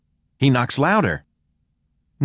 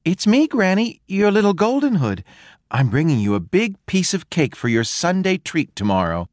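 put-on higher, bright child calling to granny; sweet child at the door; little girl voice; 8 semitones higher than usual. A narrator's reading. Girl greeting her granny through the door, higher.

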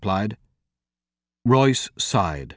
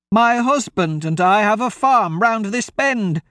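put-on high old woman's voice; a fretful farm wife pointing the way; 10 semitones higher, 3 dB louder than usual. Old woman tells the travellers where her farm is.

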